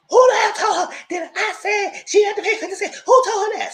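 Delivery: high, loud imitated yell with laughter; imitating a shrieking angry friend, laughing; mock furious shout. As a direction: shriek it like she's outraged. Retelling Shekinah's angry outburst.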